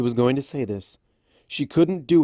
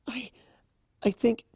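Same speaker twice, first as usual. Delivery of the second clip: timid voice; 12 semitones higher than usual. Acted. Fluttershy timid.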